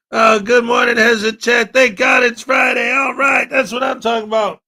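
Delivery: loud, hyped donation thanks; excited shouting. Real, not acted. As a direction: cheer every donor loudly. Streamer thanks donors loudly.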